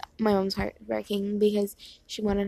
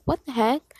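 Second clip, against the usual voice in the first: loud, higher baffled exclamation; retold burst of disbelief; confused outburst; 4 semitones higher, 7 dB louder than usual. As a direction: blurt it out in bewilderment. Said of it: "I was so confused".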